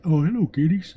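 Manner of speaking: high, loud mock-sweet greeting; Navy seal greeting kindergartners, mock sugary; sweet soldier. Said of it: "Navy seals would probably be like".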